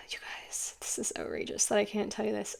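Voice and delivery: real choked-up near-whisper; emotional. Real, not acted. Reader overcome explaining the mole video to viewers, emotional. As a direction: voice drops away, overcome with feeling. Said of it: "I'm getting emotional trying to explain".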